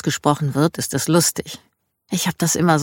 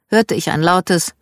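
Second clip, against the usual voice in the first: ordinary delivery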